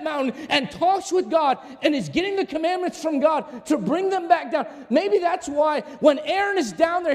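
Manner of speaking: high-pitched, driving pulpit delivery; impassioned preaching. Real, not acted. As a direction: preach it with rising force and urgency. Preaches at full force.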